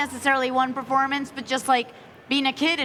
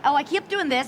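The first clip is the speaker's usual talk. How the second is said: ordinary talk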